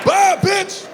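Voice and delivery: high, loud, punchy dismissal; yelled hang-up. Acted. Man slamming the phone on a caller, loud high yell. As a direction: holler it as you slam the receiver.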